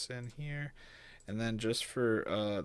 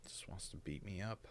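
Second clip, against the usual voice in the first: whispered grumble; 4 dB quieter than usual. Real, not acted. Software frustrates him.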